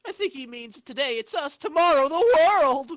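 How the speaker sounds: high, loud gloating cry; mock villain boast. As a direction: crow it like a cartoon villain.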